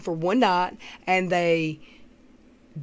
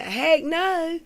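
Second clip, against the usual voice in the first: mock dad; 8 semitones higher than usual. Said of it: "his daddy went".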